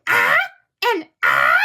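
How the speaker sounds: loud hammed-up chimp mockery; mocking cry. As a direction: ham up a cartoon chimp mocking her. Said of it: "he goes".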